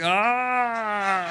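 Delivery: long, drawn-out yawning "ah"; rapturous yawn. Acted. Imagining being raptured by God, blissful drawn-out yawn. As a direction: yawn as if floating up to heaven. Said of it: "like to be raptured by God and float to heaven".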